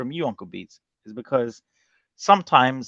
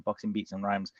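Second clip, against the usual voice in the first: ordinary delivery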